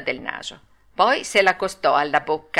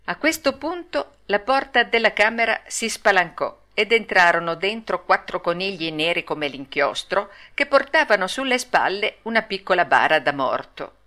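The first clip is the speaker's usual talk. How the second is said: usual speaking voice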